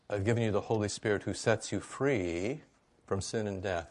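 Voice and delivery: ordinary talk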